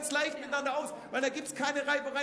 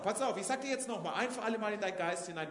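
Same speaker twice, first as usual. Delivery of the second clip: usual speaking voice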